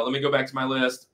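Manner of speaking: ordinary delivery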